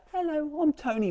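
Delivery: tiny high-pitched key voice, softly; Tony, little whisper. Acted. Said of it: "says it in a little whisper".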